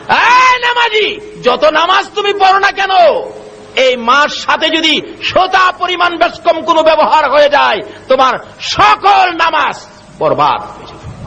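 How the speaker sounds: high, shouted sermon rebuke; full-force preaching. Real, not acted. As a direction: cry out a warning to the congregation. (উঁচু গলায় ওয়াজের হুঁশিয়ারি)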